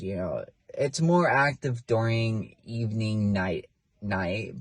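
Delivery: usual speaking voice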